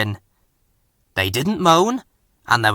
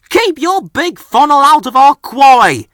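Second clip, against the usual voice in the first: much higher, much louder rude retort; rude huff; 13 semitones higher, 9 dB louder than usual. A narrator's reading. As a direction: huff it out rudely at him. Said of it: "huffed Ben rudely".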